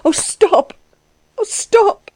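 high, pleading cry of protest; high-pitched, upset woman's plea; distressed plea. Acted. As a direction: beg him to stop in a high, distressed voice. Woman pleading with insistent suitor.

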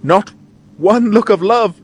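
high, drawn-out theatrical plea; pleading cry. A narrator's reading. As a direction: implore him like a tragedy heroine. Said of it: "she cries".

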